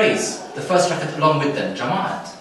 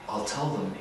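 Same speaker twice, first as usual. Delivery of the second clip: low, soft and calm; quiet, low reply; 5 semitones lower, 9 dB quieter than usual. Retold or imitated. Old man quietly answers the Ayatollah, low. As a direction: say it quietly, unbothered. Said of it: "The man looks up and he says".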